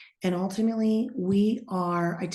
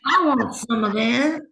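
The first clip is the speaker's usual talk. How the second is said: eager fan; 7 semitones higher, 8 dB louder than usual. Pretend. Reader echoing the listener who wants a session, loud and high. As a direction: play up the hungry fan.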